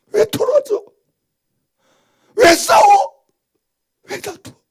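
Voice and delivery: high-pitched rebuke from the pulpit; high scolding preaching. Real, not acted. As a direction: scold them, pitch climbing.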